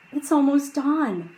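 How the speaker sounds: put-on young boy voice. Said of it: "said Jack".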